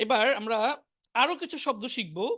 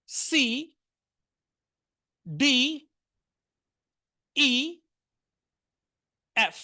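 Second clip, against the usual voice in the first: alphabet drill; much slower than usual. Shown as a demonstration. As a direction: call out each letter for the class to repeat. Teacher drilling alphabet aloud.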